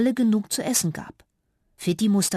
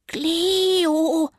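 high, loud squeaky call; shouted Cleo in a high mouse voice; loud squeak; 9 semitones higher, 4 dB louder than usual. Acted. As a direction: squeak the name out as loud as a tiny mouse can. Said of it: "piepste, so laut sie konnte".

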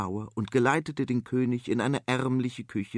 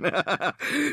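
wild laughter; 8 semitones higher than usual. Acted. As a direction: cackle wildly. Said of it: "stieß der Bauer ein wildes Gelächter aus". (wildes Gelächter)

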